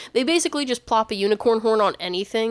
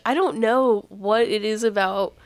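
usual speaking voice

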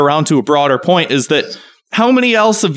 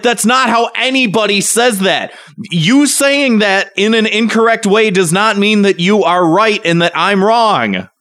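exasperated rant; 8 semitones higher than usual. Retold or imitated. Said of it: "And it's like".

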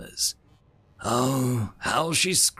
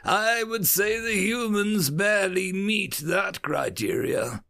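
mocking scoff; 10 semitones higher, slower than usual. A narrator's reading. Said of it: "the barking laugh".

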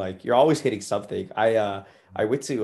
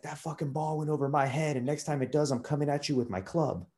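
higher, softer imitation of an angry threat; mock angry; 6 semitones higher, 5 dB quieter than usual. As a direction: imitate a mad golfer making threats, voice raised in pitch but held back in volume. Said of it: "he starts getting all mad".

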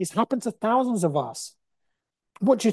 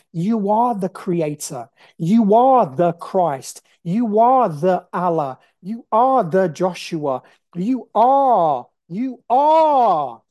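louder, slow proclamation; preaching climax; 5 dB louder, slower than usual. Real, not acted. Telling viewers they are the creator, loud and slow. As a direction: declare it like a sermon peak.

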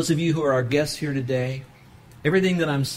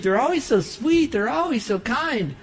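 mock sugary praise; 8 semitones higher than usual. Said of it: "those people that you think".

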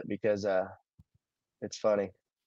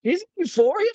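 surprised. Real, not acted. Shocked to learn of the actress.

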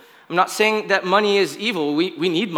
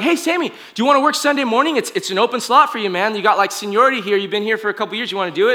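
higher, quick pitch to a colleague; eager coworker offering a shift; 6 semitones higher, 4 dB louder, faster than usual. Retold or imitated. Coworker offers the preacher an open Sunday morning slot, quick and eager. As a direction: imitate a coworker talking fast and upbeat. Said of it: "when they'd be like".

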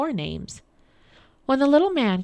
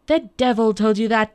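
loud, outraged little man; angry cry; 3 semitones higher, 8 dB louder than usual. A narrator's reading. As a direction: cry out in anger, holding a little back. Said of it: "cried the little man angrily".

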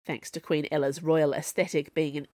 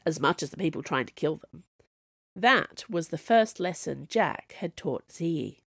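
plain reading voice

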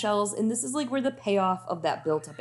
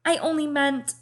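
high frightened plea; 8 semitones higher than usual. Acted. Sansa pleads with king.

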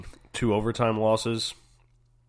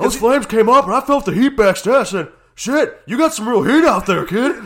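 much higher, much louder put-on old man; old wrestling host; 15 dB louder than usual. Imitating a wrestling legend grilling a guest, hammed up. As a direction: ham up a cranky old interviewer.

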